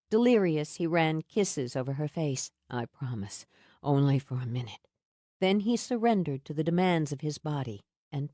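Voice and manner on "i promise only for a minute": low hurried comfort. A narrator's reading. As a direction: reassure her, low and urgent. Sam soothing her again.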